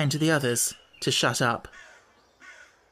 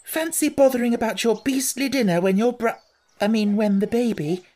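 higher, sharp scolding under breath; hot whisper; 8 semitones higher, 3 dB louder than usual. A narrator's reading. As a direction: scold your brother heatedly. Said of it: "Jane whispered hotly".

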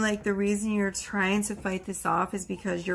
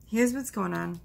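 ordinary talk, nothing marked

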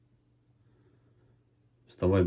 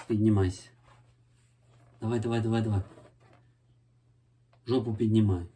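coaxing a puppy to get up; higher urging at a dog; talking to a dog; 3 semitones higher, much faster than usual. Real, not acted.

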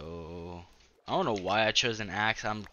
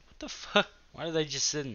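startled; 6 semitones higher than usual. Real, not acted. Startled by dolphins.

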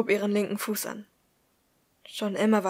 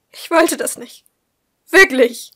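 high, loud, panicked insistence; distraught; 8 semitones higher, 15 dB louder, slower than usual. Acted. Woman confessing to her friend by text, distraught. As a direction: insist desperately, voice raised.

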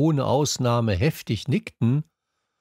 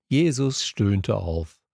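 ordinary delivery, nothing marked

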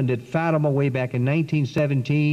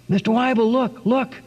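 excited cry; 9 semitones higher than usual. Retold or imitated. Girl calling her teacher to her rosary, excited cry. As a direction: call out in astonishment. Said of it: "one of them suddenly screamed out".

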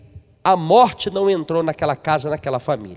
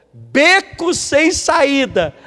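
high, slow, with a laugh in it; amused, drawn-out answer; 7 semitones higher, much slower than usual. Real, not acted.

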